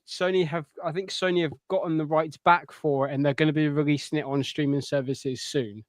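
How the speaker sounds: normal talking voice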